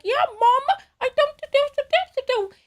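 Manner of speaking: high, strained mock British working-class accent; UK worker accent. Host imitating cartoon workers under the pipes, badly faked UK accent. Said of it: "I don't know how to do the accent".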